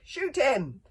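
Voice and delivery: high, very loud scream; screamed command. A narrator's reading. Villain ordering his warriors to fire, scream. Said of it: "screamed Alvin to the warriors".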